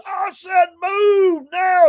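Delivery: high, slow, drawn-out yell; retold scream. Motorcycle cop screaming at a hesitant old driver, loud yell. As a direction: bellow the order like an angry cop. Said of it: "He has screamed at that guy".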